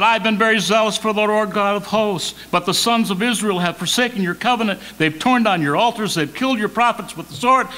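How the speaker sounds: acted-out aggrieved prophet; Elijah's complaint. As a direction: pour out the grievances in one urgent run. Reading Elijah's complaint aloud.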